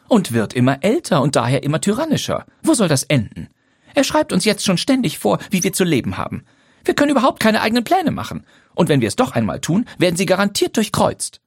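wife venting her frustration in a rush; building exasperated rant; Lydia, heated. A narrator's reading. Lydia's held heated complaint.

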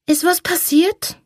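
put-on high, drowsy child voice; sleepy little girl. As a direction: ask it like a just-woken child.

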